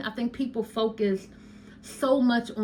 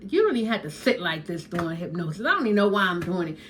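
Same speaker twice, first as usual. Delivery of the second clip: mock sceptic; 3 semitones lower, much faster than usual. Mocking a sceptic's excuse.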